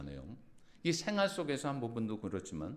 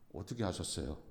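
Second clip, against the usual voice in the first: humble reply; 5 semitones lower, 6 dB quieter than usual. Retold or imitated. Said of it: "제가 뭐라 그랬는지 아세요?".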